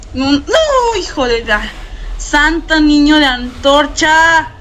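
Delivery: ordinary talk, nothing marked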